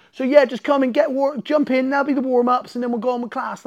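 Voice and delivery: high, loud, breezy instructions; mock breezy coach. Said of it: "and I'm like".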